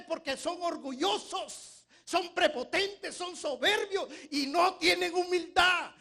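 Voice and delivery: high, loud preaching, slow and hammered; fiery preaching. Acted. Preacher thunders at proud church members, loud and slow. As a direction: preach it out full force.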